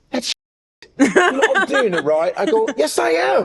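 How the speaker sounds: American putting on British, high-pitched and laughing; mock British accent. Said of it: "when Americans do British accents".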